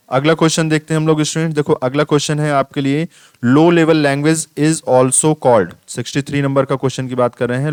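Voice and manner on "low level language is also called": question read aloud. Acted.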